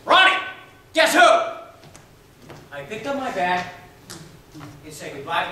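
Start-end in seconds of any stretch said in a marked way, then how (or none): 0.1-1.5 high, even louder shout; louder call. Retold or imitated. Father calling his son a second time, louder shout. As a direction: call again, bigger and more insistent. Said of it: "my father called out".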